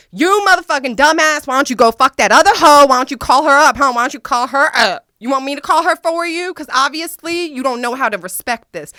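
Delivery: screamed high and loud at him; retold furious yelling. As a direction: tear into him, outraged. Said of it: "And so I started going off".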